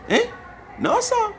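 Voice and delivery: stunned no sir, voice leaping up; shocked no. Real, not acted. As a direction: yelp it out in shock. Stunned reaction to news.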